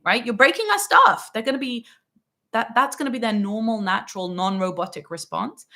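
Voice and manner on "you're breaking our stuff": put-on much higher, louder snap at a child; irritated parent. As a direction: scold the kid for real annoyance. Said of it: "They're gonna be irritated".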